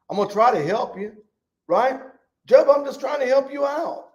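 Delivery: high-pitched, pushy helper; insistent offer of help. Acted. Elihu pressing help on Job, high insistent plea.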